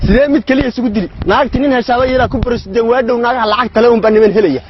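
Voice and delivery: ordinary talk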